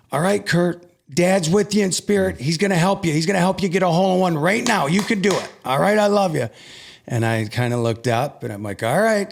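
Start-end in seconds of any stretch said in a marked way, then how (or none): 0.1-6.5 mother's high, fast, eager encouragement; excited mom. Retold or imitated. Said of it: "my mom got closer and she said". 8.8-9.3 humoring her. Retold or imitated. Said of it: "kinda humoring her".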